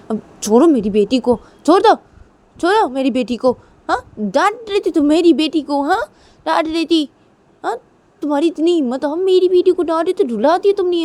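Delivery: loud, high-pitched protective outrage; indignant confrontation. Acted. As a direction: tell off your partner for making your child cry. Father confronts mother over scolding daughter. (गुस्से में फटकारते हुए)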